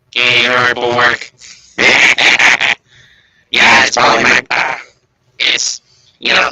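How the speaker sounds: loud distorted digital voice; robot voice. Real, not acted. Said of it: "You really sound like you ... had a tracheotomy".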